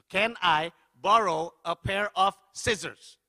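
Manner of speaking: drawn-out request with gaps between words; slow, over-careful English. Retold or imitated. Guest repeats a request to a baffled receptionist, slow and over-careful.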